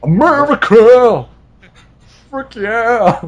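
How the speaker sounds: high, loud, hammed-up rallying cry; exaggerated chest-thumping shout; mock patriotic cheer. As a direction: holler it like an over-the-top patriot. Mock patriotic cheer after "I'm American.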